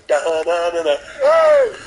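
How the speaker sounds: triumphant da-da-da into a high "hey!"; sung fanfare and cheer. Acted. Comic voicing the feeling of earning it, celebratory cheer.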